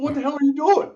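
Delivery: mock exasperated old dad, pitched well up; dad's baffled outburst. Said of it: "He's going".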